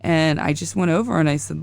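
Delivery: usual speaking voice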